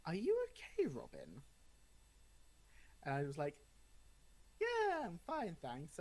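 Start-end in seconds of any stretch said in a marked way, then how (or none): 0.0-1.4 high, concerned parent voice; worried parent. Retold or imitated. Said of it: "my parents going". 4.6-6.0 high, breezy fake calm; falsely casual. Retold fake reassurance.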